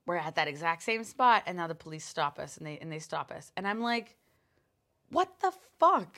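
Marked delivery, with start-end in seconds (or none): 5.1-6.2 high, loud, slow what the fuck; retold shocked outburst; incredulous outburst. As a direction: blurt it in disbelief. Retold outrage at police stop.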